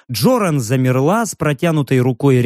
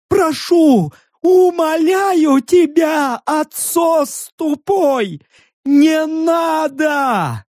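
high, slow wailing plea; desperate begging; 12 semitones higher, much slower than usual. A narrator's reading. Stranger begs orc to spare the bear, desperate wail. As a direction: beg at the top of the voice, drawing vowels out.

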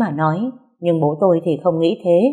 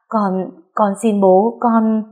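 slow, halting, slightly raised plea; pleading; 4 semitones higher, much slower than usual. A narrator's reading. Daughter begging angry father, hesitant frightened plea. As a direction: beg slowly, stumbling over the words.

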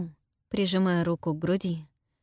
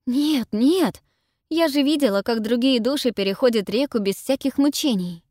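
higher, louder, panicking; panicked; 5 semitones higher, 5 dB louder than usual. A narrator's reading. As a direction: blurt it out in panic. Gemma panicking.

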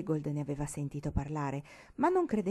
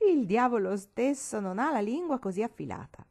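admiring exclamation; 5 semitones higher, 3 dB louder than usual. A narrator's reading. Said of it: "esclamò la vecchia con ammirazione".

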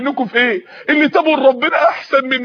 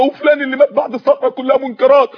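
ordinary talk, nothing marked

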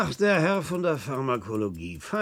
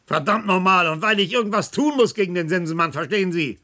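higher and louder, exasperated rant; angry outburst; 7 semitones higher, 4 dB louder than usual. Acted. Clinic chief vents at the pharmacologist about being hounded, angry and raised. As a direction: let the frustration boil over, voice climbing. Chief venting under pressure.

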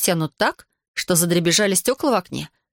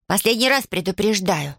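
usual speaking voice

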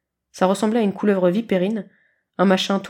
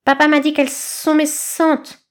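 slow hissing snakelet; 7 semitones higher, 4 dB louder, slower than usual. A narrator's reading.

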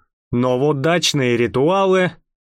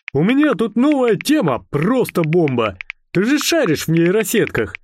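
higher-pitched, hyped-up pitch; excited startup friend; 6 semitones higher than usual. A narrator's reading. Eternal startupper messaging a friend his new idea, excited. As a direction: sell the idea with eager enthusiasm.

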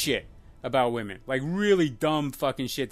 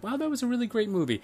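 high, fast gushing quote; impressed viewer; 9 semitones higher, faster than usual. Retold or imitated. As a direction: gush like a wowed moviegoer.

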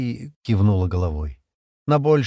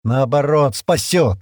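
louder, firm reassurance; contradicting; 5 semitones higher, 5 dB louder, slower than usual. A narrator's reading. Стэн contradicts Шира's fear of the hunter, firm. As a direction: counter the fear firmly. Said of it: "возразил Стэн".

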